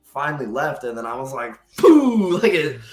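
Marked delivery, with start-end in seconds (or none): 1.8-2.4 sudden loud burst of relief; explosive pooh. Retold or imitated. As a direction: blow it out like a release. Abuser left, release.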